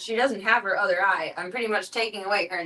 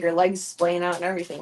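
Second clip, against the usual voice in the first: usual speaking voice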